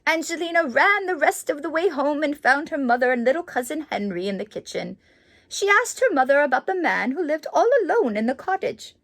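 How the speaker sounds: lively storybook narration; reading to children. A narrator's reading. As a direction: read it like a bedtime picture book. Reads the book aloud.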